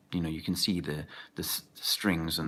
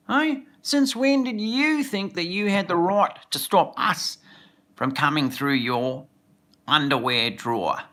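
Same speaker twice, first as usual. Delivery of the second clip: mock Australian official, much higher and louder; a hammed-up Aussie accent, pitched up and loud; bad Australian accent; 9 semitones higher, 8 dB louder than usual. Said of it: "I massacred the Australian accent there".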